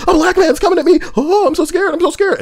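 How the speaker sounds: high, breathy fake fright; mock scared Karen. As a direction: ham up a helpless damsel calling for help. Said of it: "You on camera talking about".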